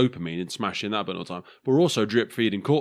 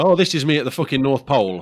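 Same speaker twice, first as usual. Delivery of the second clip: mocking boast; 5 semitones higher, 6 dB louder than usual. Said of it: "I take the piss".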